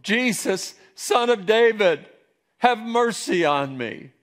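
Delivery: loud, high plea for mercy; calling out. Acted. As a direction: cry out like a blind beggar by the road. Blind man calls out to Jesus.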